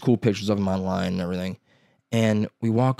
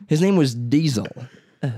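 ordinary talk

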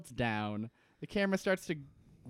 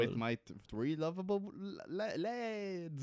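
softer, faltering mock Liverpool accent; attempted Liverpool accent; 3 semitones higher, 6 dB quieter than usual. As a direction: stumble through a bad Liverpool accent. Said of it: "I can't, I can't do an accent".

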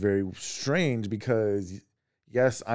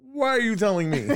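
exasperated; 11 semitones higher, 4 dB louder than usual. Retold or imitated. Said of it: "I'm like".

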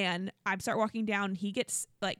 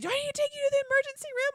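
much higher, fast, anxious question; worried husband; 4 dB louder, much faster than usual. Retold or imitated. Husband asking hurt wife, high fast worry. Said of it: "And he's like".

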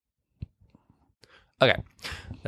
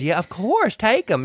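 higher, easygoing southern lady; 8 semitones higher, slower than usual. Retold or imitated. Dolly offering the magazines, breezy. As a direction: toss it off generously.

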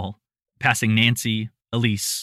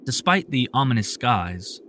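ordinary delivery, nothing marked

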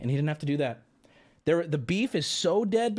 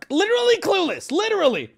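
exasperated outburst; 12 semitones higher, 5 dB louder than usual. Real, not acted. Exasperated at chat comment.

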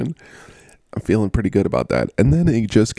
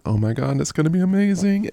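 hopeful excitement; 8 semitones higher, faster than usual. Retold or imitated. Quotes own excited early hopes.

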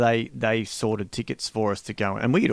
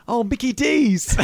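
put-on slow, high English accent on Mickey D's; 12 semitones higher, 5 dB louder than usual. Drummer [name] naming McDonald's, in an English accent. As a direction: drawl it like a Londoner. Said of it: "that's what they call it over there".

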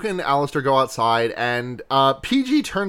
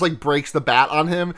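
ordinary talk, nothing marked

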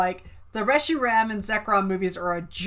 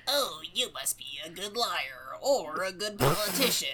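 odd character voice; 4 semitones lower, 7 dB quieter than usual. Retold or imitated. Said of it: "Why are you doing his voice like that".

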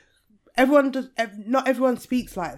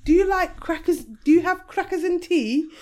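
higher-pitched, hammed-up English accent; mock English accent; 7 semitones higher than usual. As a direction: imitate Americans trying an English accent. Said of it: "in their best English accent".

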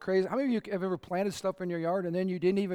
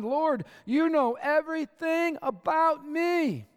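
much higher and slow, lifted in awe; reverent reading; 10 semitones higher, 5 dB louder, slower than usual. Acted. Preacher reading Psalm verse to congregation, slow and lifted. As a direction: read the psalm verse like a prayer of wonder. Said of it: "Verse one".

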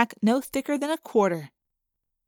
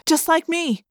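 ordinary delivery, nothing marked

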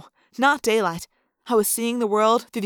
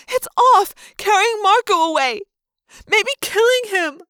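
high, loud wail, slowing at the end; young girl wailing for her friend; 9 semitones higher, 5 dB louder than usual. Acted. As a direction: wail it in despair. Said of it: "Cassie wailed".

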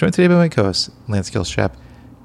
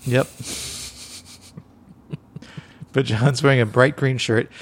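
ordinary talk, nothing marked

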